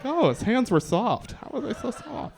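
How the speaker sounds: woman charmed by a British groper; swooning woman. Retold or imitated. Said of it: "They're like".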